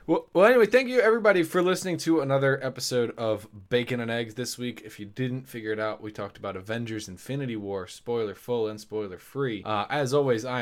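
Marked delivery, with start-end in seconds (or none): 0.1-4.8 bright, loud host sign-off, pitched up; podcast outro. Acted. Host thanking listeners, upbeat announcer outro. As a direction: wrap the show like a radio host.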